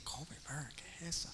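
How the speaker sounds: hushed, confused murmur to himself; softly wondering what Kobe is; puzzled whisper. Retold or imitated. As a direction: ask it under your breath, baffled. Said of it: "puzzled whisper to himself".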